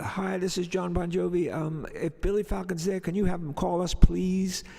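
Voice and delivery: high, loud answering-machine greeting; phone message voice. Retold or imitated. Replaying the [name] voicemail.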